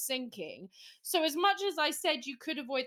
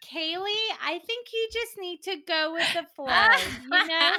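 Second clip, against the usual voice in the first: hippy friend voice; 6 semitones higher, 5 dB louder than usual. Pretend. Friend Molly soothing Kaylee after her boat sank, airy hippy voice. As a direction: play the laid-back friend drawling go with the flow.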